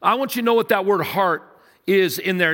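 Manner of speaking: ordinary talk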